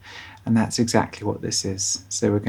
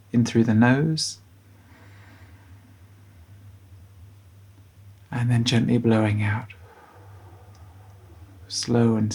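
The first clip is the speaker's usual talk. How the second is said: guided bedtime meditation. Acted. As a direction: guide children gently towards sleep, unhurried.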